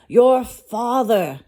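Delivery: slow, high, loud alarmed cry; nearly fainting with shock; horrified crab voice. A narrator's reading. Crab panics at mention of father.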